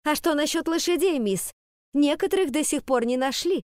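high, indignant call-out; angry shout. A narrator's reading. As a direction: shout it angrily across the hall. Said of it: "сердито выкрикнула".

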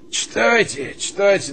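high, loud laughing urge. Acted. Colonel laughing as he demands the play. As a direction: laugh and egg her on.

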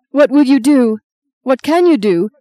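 high, loud anguished questions; distressed pleading. Acted. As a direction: press mum helplessly in grief. Distraught daughter questions bereaved mother.

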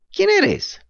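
very high puppet voice; Pinocho's voice. Acted. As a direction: squeak it out as the puppet. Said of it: "le preguntó Pinocho". (voz muy aguda de marioneta)

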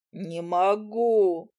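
mild sobbing, very slow; a touch of a sob in the refusal; sobbing cry. Acted. Willy hanging over the crack, sobbing mildly. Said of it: "прорыдал он".

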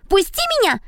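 put-on high squealing girl voice; shrieking girl. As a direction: squeal to be let go. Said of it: "заверещала Мари".

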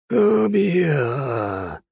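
high, strained demon threat; moaning monster. Acted. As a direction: groan it like a dying beast. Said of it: "простонал монстр".

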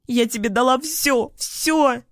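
high, wounded protest; shouting through tears. A narrator's reading. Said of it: "кричит она сквозь слёзы". (сквозь слёзы, надрывно и высоко)

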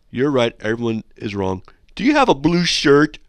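loud, high-pitched parody of a challenge; mock non sequitur. Host parodying a listener's illogical email, mock challenge. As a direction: fire off an absurd question as mockery.

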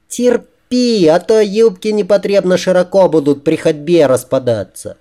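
high female voice, starting very high; bossy woman. A narrator's reading. She tells him to endure the pinning, high and bossy.

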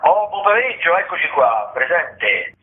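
ordinary talk, nothing marked

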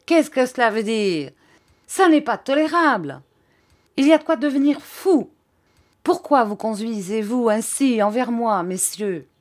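loud, indignant scolding at the end of one's tether; exasperated cry. A narrator's reading. Teacher driven mad by his pupils, exasperated cry. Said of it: "cria monsieur Mel".

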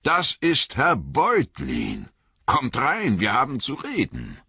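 high, hearty farmer's exclamation; excited farmer calling guests inside; exclaiming. A narrator's reading. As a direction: announce the discovery, then usher them in. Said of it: "rief er".

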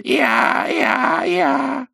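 strangled hoarse cry, rising to a wail; hoarse croak. A narrator's reading. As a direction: squeeze it out of a tight throat. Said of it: "выдавилось только хриплое". (хриплый сдавленный крик)

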